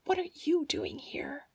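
put-on old woman hissing at the girl; hissed. A narrator's reading. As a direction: hiss it at the intruder. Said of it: "the old woman hissed".

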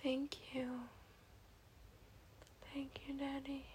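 very soft, whispery thanks; spent afterglow. Acted. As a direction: murmur it faintly, out of breath.

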